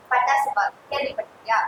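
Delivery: ordinary delivery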